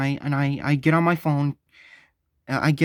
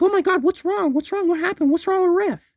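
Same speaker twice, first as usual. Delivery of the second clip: panicked; 3 dB louder than usual. Retold or imitated. Panicked wife asks what happened.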